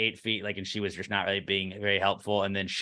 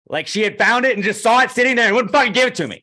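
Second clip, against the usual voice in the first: loud, much higher exasperated rant; real outrage; 12 semitones higher, 8 dB louder than usual. Real, not acted. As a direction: let the anger spill over, loud and high. Furious rant about withheld hat.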